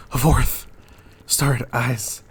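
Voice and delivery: alarmed outcry; rising horror. Acted. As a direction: exclaim in mounting dread. Macbeth counting kings in horror.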